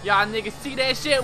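usual speaking voice

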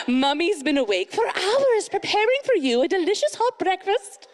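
high, hammed-up British mummy; British accent. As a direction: trill it like a perfect British nanny. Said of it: "in the future I'd be British".

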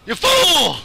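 high, loud hammed-up cry; mock villain taunt. Gamer taunting the man he chases, hammed-up shout. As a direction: crow it like a cartoon villain.

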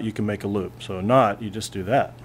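usual speaking voice

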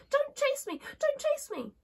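very high, tiny china-princess cry; acted high-pitched alarm; frightened little voice. A narrator's reading. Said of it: "such a frightened little voice".

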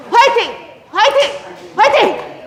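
three high shouted cheers; rally the class with a shout. Shown as a demonstration. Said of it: "자, 다시 해볼게요".

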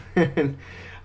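brief, light chuckle trailing off; short chuckle. Real, not acted. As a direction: chuckle quickly to yourself. Chuckles while explaining crawling.

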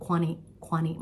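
quanti- with an American flapped t, not a full ti; American accent. Shown as a demonstration. Teacher showing students American quanti-, light American accent. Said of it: "ถ้าพูดแบบเป็นความอเมริกันนิดนึง".

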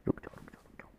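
unvoiced whisper of stop; hushed wait, wait. Real, not acted. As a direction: keep it silent while creeping. Sneaking near enemies.

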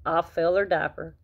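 ordinary delivery

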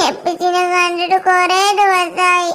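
put-on slow, drawn-out tearful child voice; crying child. Child missing absent father, acted crying. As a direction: whimper it out slowly like a child. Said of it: "കരയല്ലേ ട്ടോ".

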